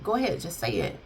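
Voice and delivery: soft, expressionless prompting; nonchalant. Retold or imitated. Husband prompting his wife to speak, nonchalant. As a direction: toss it out quietly, no feeling in it. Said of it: "like, nonchalant".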